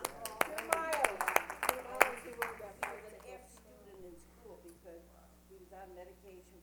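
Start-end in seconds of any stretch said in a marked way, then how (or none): 0.0-3.0 much louder, stretched exclaim; proud exclaim. Real, not acted. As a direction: shout it out with pride.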